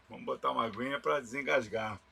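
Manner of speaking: usual speaking voice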